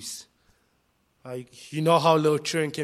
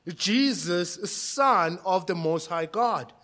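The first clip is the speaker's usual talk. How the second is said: usual speaking voice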